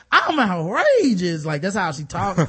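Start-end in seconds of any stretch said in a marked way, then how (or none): 0.1-1.4 slow, higher, louder drag auntie voice; hammed-up Auntie Mama line. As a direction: camp it up slowly. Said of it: "the voice of Auntie Mama is all like".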